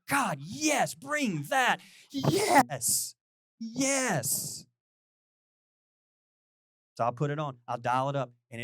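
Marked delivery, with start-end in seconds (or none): 0.1-4.7 high, fervent drawn-out yeses; rapturous cheering. Acted. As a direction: urge the singer on like an ecstatic fan. Acting out rapture at [name] song.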